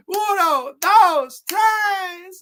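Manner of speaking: high, loud referee pin count. Pretend. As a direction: count the three like a ring referee.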